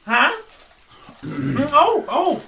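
put-on high quavery old lady; startled old woman.

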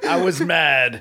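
amused mock confession; laughing. Real, not acted. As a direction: toss in the excuse through laughter. Co-host riffs on the confession.

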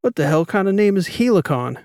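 loud, high-pitched scoffing question; incredulous mockery. A narrator's reading. Captain mocks the assigned cover name, incredulous. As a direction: ridicule the name with rising disbelief.

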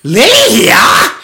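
high, slow, loud doll cry; creepy doll shriek. Retold or imitated. Host echoing the evil friend doll, creepy shriek.

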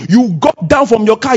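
ordinary talk

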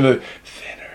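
soft, eerie whisper; whispered curse. Acted. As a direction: breathe it like a curse.

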